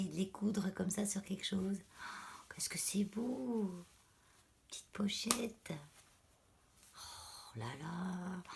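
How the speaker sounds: real whisper, no voice left; hushed whisper from a lost voice; whispering, voice gone. Real, not acted. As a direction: breathe the words out without voice. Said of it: "j'ai plus de voix".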